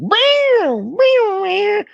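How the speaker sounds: loud, very high mouth-made sax riff; imitated saxophone wail. Mocking a TV show's background music, shrill saxophone imitation. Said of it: "a saxophone in the background".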